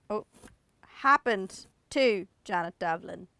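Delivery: ordinary delivery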